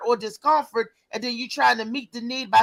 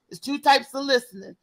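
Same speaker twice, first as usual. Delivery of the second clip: ordinary talk, nothing marked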